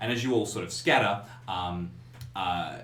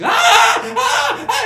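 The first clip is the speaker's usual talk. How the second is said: high, very loud shrieks; two piercingly high screams of shock; full-throated scream; 16 dB louder than usual. Acted. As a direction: scream at the top of your lungs. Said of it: "begins to scream at the top of her lungs".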